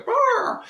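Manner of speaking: very high, loud imitated peacock cry; peacock call. Host showing a listener the peacock's call, loud high imitation. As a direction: mimic a peacock's piercing call. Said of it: "we do have the peacock going".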